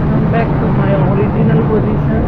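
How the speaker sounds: shouting above the wind on a ride; loud over wind. Real, not acted. Riding fast, speaking over wind.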